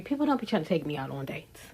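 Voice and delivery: fast unvoiced whisper aside; breathy fast confiding whisper. Real, not acted.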